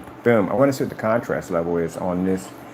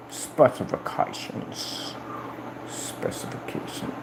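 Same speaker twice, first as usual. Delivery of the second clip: slow, much softer, breathy whisper; whispered drawl; 2 semitones higher, 9 dB quieter, much slower than usual. Acted. Reading the spec page, a slow drawn whisper. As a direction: stretch it out in a whisper.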